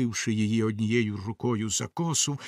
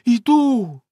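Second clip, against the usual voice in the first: a louder, higher cry of despair; desperate reply; 10 semitones higher, 8 dB louder than usual. A narrator's reading. The wife answering her husband, desperate. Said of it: "розпачливо сказала дружина".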